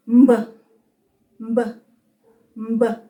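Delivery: "Mb" as one short hummed-m-into-b sound; letter-sound demonstration. Shown as a demonstration. Teacher demonstrates the sound "Mb", m blended straight into b. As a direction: say "Mb" as isolated blended sounds, pausing between. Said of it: "The sound of this week is".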